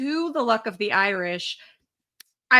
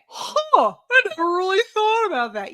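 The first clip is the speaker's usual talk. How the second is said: higher, mock-dopey huh and a quick realization; a dopey, wide-eyed realization, high and quick; mock dumb realization; 9 semitones higher, 4 dB louder than usual. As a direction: play him as a clueless kid finally getting it. Mocking Kyle's dumb realization.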